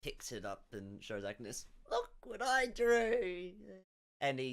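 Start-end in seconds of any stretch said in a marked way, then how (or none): 1.9-3.5 put-on high, drawn-out childlike squeal; excited child. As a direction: show it off proudly like a little kid. Excited child figure shows off drawing.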